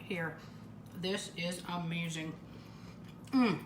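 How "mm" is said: ordinary talk, nothing marked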